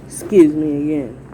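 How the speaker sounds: usual speaking voice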